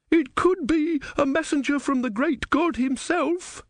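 high, reverent woman's voice; breathless pious woman. A narrator's reading. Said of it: "said the woman".

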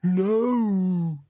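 high, long wailed monster protest; high, drawn-out creature moan; dismayed bogeyman. Acted. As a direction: wail it as a horrified bogeyman. Bogeyman dreads the blanket.

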